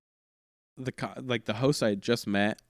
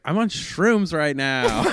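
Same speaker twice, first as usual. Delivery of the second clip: loud, slow, higher imitation; put-on lady's voice; 5 semitones higher, 7 dB louder, slower than usual. Nurse practitioner confessing to her patient, loud drawn-out imitation. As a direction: draw it out loudly as the woman. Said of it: "people with those voices".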